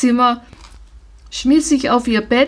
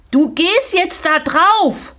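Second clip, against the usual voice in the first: angry shout; 7 semitones higher than usual. Acted. Father yells at the pony to get on the trailer, angry shout. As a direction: bark the order at the pony. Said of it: "schrie er".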